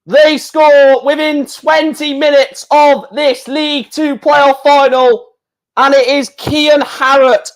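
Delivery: high-pitched, emphatic celebration easing slightly; thrilled football commentator riding the goal; excited goal commentary. Real, not acted. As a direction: proclaim the scorer with real excitement. Live play-by-play after the goal.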